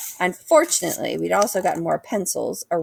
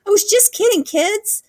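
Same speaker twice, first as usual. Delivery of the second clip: much higher, louder call; calling after; 5 dB louder than usual. A narrator's reading. Woman calling after running kids, high and cheerful. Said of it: "called after us".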